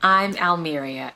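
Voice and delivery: plain reading voice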